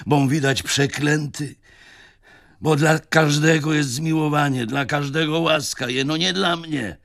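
rising despair, feeling cursed by God; grieving lament. Acted. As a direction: knight pours out his grief to his old friend. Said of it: "z głębokim żalem".

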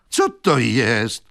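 slow, loud, drawn-out question; a confident stranger stepping in; young man asking. Acted. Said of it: "zapytał młody". (powolne, głośne pytanie młodzieńca)

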